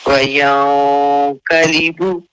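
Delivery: وينقلب with the nun hidden, slow; don't sound the nun clearly in وينقلب. Shown as a demonstration. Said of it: "यनقلب नहीं करना".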